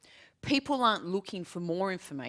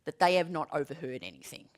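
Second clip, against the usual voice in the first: ordinary delivery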